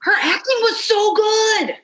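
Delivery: high-pitched, emphatic rave; excited gushing. Real, not acted. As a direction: burst out with high, gushing praise. Gushing over a favorite actress.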